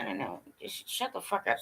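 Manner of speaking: ordinary talk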